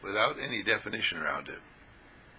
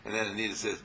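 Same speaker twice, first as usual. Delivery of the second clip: usual speaking voice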